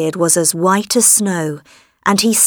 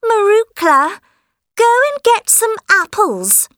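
put-on high bossy demanding girl; spoiled girl voice; 14 semitones higher than usual. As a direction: order her around, high and spoiled. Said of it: "Helen said".

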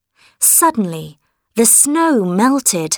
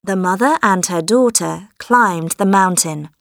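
plain reading voice

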